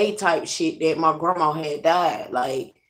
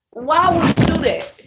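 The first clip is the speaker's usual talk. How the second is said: high, loud exasperated outburst; 9 semitones higher, 5 dB louder than usual. Retold or imitated. To his mom who dropped her weight, a loud exasperated why. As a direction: demand why she did that.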